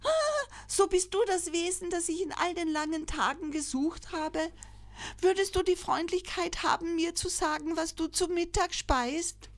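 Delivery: put-on a small child who has finally found what it searched for; joyful cry. A narrator's reading. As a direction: elephant calf calling out happily to the crocodile. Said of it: "rief freudig".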